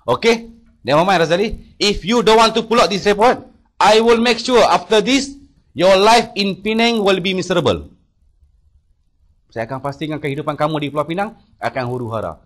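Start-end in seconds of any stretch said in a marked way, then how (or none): 0.1-7.9 loud, high, slow threat; imitating the boss's shouted threat; threatening shout. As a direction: warn him his life will be ruined, loud and deliberate. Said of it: "Terus dia maki saya".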